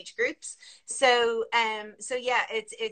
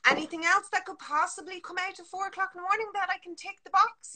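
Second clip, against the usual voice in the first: high; 9 semitones higher than usual. Acted.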